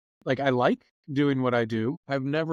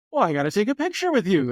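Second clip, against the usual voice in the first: 1 eager fan; 8 semitones higher, 4 dB louder than usual. Retold or imitated. Fan demands photo.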